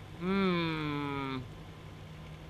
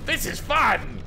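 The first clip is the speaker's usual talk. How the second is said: excited; 6 semitones higher, 5 dB louder, slower than usual. Real, not acted. Excited exclaim.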